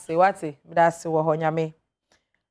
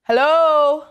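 calling out; 5 semitones higher, 4 dB louder than usual. Real, not acted. Host calling silent caller again.

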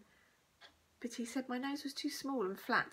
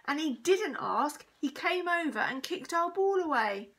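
high, loud indignant protest; cross child pleading her case; angry; 6 semitones higher, 7 dB louder than usual. A narrator's reading. Girl accuses the bully to teacher, angrily. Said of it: "said Josie angrily".

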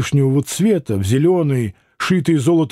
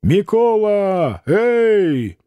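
calling out; 5 semitones higher, much slower than usual. Acted. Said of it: "крикнул".

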